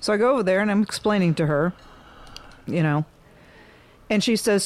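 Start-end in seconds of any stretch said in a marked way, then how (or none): none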